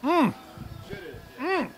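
high, pleased mm over a mouthful; savoring hum. Real, not acted. Guest tasting his hamburger after the interview, happy high hum. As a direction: hum with delight at the food. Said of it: "I'm gonna take a bite of my hamburger".

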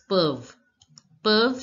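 peuvent as "puhv", said twice; one-syllable peuvent, repeated; silent ent. Shown as a demonstration. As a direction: end peuvent on v, dropping the ent. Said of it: "E N T is not pronounced in French".

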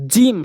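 "জিম" with a strong, voiced J; forceful jim. Shown as a demonstration. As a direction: hit "জিম" hard from mid-tongue on palate. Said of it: "এরকম শক্তি দিয়ে".

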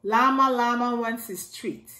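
ordinary delivery, nothing marked

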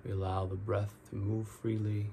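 guided meditation. Real, not acted. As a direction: ease them into each breath, drawing out the key words.

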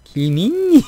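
very slow, climbing Busan name call; Busan accent. Retold or imitated. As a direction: stretch the name out in Busan tones. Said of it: "막 이러던데". (아주 느리고 높아지는 부산 억양)

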